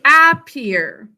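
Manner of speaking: "appear" as AP-PEER, short a as in cat; spelling pronunciation. Shown as a demonstration. Teacher tells class how to think to spell it, "appear" as AP-peer. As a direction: say "appear" with a full, clear first vowel. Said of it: "How should we think to spell this?".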